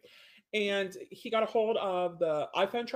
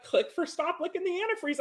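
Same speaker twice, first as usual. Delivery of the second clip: high, airy mock trainer voice; a breezy, ineffective little reminder; mocking a timid clicker trainer; 9 semitones higher than usual. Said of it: "you're not gonna be like".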